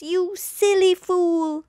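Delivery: high, jeering animal voice; mocking jeer. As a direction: sneer it up high. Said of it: "the animals all laughed".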